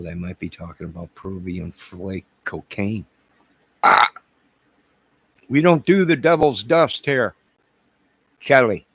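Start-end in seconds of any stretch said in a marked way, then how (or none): 3.8-4.1 short, loud "Ah!"; sharp outburst "ah". Real, not acted. Host cutting off talk of cocaine, sharp outburst. 5.5-8.9 raised, high scolding call; loud scolding. Acted. Host telling Kelly off about devil's dust, loud scolding.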